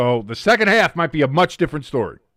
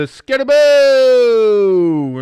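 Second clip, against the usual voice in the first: excited call; 4 semitones higher, 7 dB louder, much slower than usual. Real, not acted. Play-by-play call of big run.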